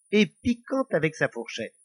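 ordinary delivery, nothing marked

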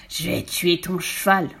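slow, drawn-out villain's roar; furious yell. Acted. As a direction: howl the threat, stretching the words. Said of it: "poussa un hurlement si terrible". (hurlement de rage, mots étirés)

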